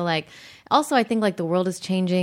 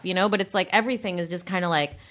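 normal talking voice

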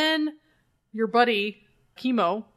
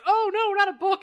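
higher, hammed-up horror over a book; fake-gasp at the book, pitched up; mock dismay; 10 semitones higher than usual. Ninja seeing the book kicked at him, mock dismay. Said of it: "And the guy goes".

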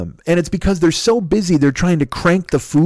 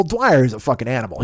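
normal talking voice

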